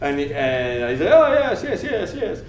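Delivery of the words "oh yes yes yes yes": high-pitched, over-eager mock patient voice; hammed-up rush of agreement; agitated patient. Injured man answering the medic's question, acted agitated.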